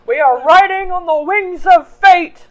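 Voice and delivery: put-on loud, slow, gruff old sailor; salty old man. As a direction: bellow it like a weathered old seadog. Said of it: "Why do you sound like a salty old man?".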